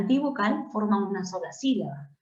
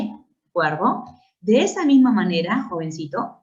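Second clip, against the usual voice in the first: usual speaking voice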